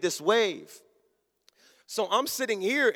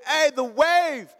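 higher, drawn-out warning call; 6 semitones higher, 3 dB louder, slower than usual. Retold or imitated. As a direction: slowly call out to distracted family. Warns family of the wave.

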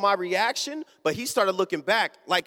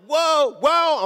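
mock startled whoas, higher and louder; alarmed whoa; 7 semitones higher, 5 dB louder than usual. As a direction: imitate someone losing balance. Wobbling passenger cries out.

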